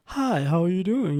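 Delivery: mock standard accent, put-on and polite; crisply put-on non-New Orleans accent; code-switched proper voice. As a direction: imitate an over-proper non-Southern accent. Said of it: "I'm not gonna be in New Orleans".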